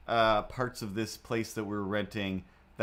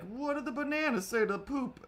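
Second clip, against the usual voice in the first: squeaky cartoon voice, much higher; poop character voice; 13 semitones higher than usual. Poop asking the banana a joke, high cartoon voice. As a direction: play a tiny silly poop, high pitched. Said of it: "the poop is all like".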